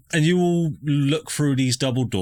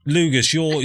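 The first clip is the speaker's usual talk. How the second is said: ordinary talk, nothing marked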